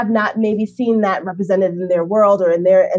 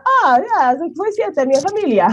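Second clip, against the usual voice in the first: playful Italian reply; 9 semitones higher than usual. Retold or imitated. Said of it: "I'm like".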